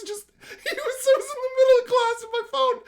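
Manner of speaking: high-pitched laughing talk; squeaky words through laughter; laughing hard. Real, not acted. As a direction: talk while cracking up. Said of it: "I'm fucking weeping, laughing".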